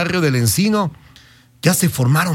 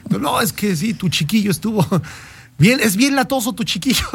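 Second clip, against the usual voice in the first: second compadre replying; 5 semitones higher than usual. Retold or imitated. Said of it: "dos compadres estaban sentados ... se deseaban salud".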